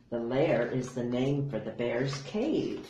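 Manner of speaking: usual speaking voice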